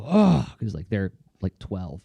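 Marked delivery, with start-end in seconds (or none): 0.0-0.5 a short, high, loud gasp of surprise; mock-stunned kid's oh, louder and higher; surprised oh. Imitating a twelve-year-old student stunned by two years, surprised oh. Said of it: "And they go".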